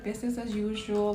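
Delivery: usual speaking voice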